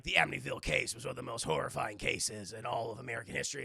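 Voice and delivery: trailer narrator. Retold or imitated. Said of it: "the opening text of".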